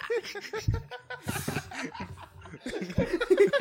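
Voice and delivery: cracking up hard; big laugh. Real, not acted. Cohost laughing at the dark TikTok joke. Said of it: "no sé por qué le da tanta risa".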